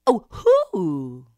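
long hoot, then loud high verdict; hoot, pause, name the culprit; Mother Owl answering. A narrator's reading. Mother Owl names the culprit, hooting accusation. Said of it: "The owl said".

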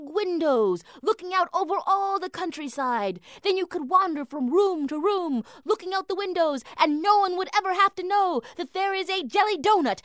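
high, loud eager persuading; gushing excitement. Acted. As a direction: sell her the dream eagerly. Husband gushes enthusiastically.

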